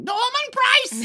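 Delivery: high, slow, long calling cry of the name; Welsh cartoon call. Calling the cartoon boy's name like in Fireman Sam, high and slow. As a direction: call across the village like a cartoon mum. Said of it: "people will hear me going".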